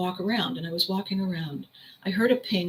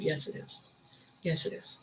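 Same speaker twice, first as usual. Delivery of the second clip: softer, whispered reassurance; soothing; 2 semitones lower, 10 dB quieter than usual. Retold or imitated. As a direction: hush her gently, almost a whisper. Friend soothing overwhelmed speaker.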